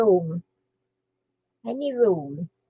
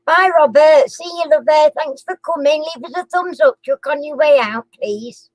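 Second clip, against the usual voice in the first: higher, bright outro to viewers; vlog sign-off; 4 semitones higher, 6 dB louder than usual. Acted. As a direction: wave the viewers off cheerily.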